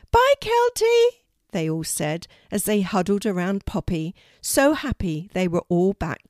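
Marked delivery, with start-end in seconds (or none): 0.1-1.2 very high, loud bird chorus; cheery farewell call. Acted. Ravens wave Kelty off, high calling farewell. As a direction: call it out slowly and squeakily.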